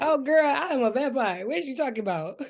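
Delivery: high-pitched mock-offended comeback; sassy mock retort. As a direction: toss it back as a sassy vampire. Joking vampire comeback to shocked woman.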